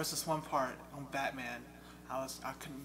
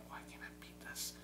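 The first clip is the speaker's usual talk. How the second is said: breathy, whispered despair; whispering in frustration at an unbeatable level; frustrated whisper; 12 dB quieter than usual. Retold or imitated. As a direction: whisper it, defeated. Retold frustration.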